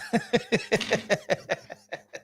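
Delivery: long laugh. Real, not acted. Streamer laughing at the photo he just showed, falling laugh. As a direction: let the laugh roll out and fade. Cracking up after time out.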